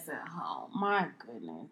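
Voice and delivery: breathless shocked whisper. Real, not acted. Host reacting to her co-host's joke, stunned whisper. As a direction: hush it under your breath in disbelief.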